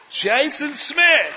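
slow, loud shouted name; excited shout. Real, not acted. Preacher cheering a student going to Bible college, slow loud shout. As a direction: cheer his name out long.

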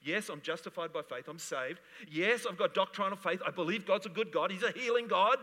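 loud, rising preacher's cry; full-force preaching. Acted. Preacher shouting faith to the congregation, full force. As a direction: preach it out to the crowd.

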